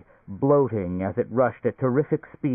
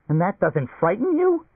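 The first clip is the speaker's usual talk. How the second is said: much higher, louder, panicky; a man terrified of a giant rat; rising shriek; 11 semitones higher, 4 dB louder than usual. A narrator's reading. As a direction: voice climbing into a scared shriek. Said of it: "his own voice rise in a shriek".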